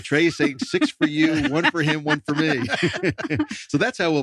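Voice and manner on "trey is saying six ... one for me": laughing. Real, not acted. Joking about splitting the pies.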